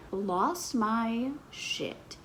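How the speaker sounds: ordinary talk, nothing marked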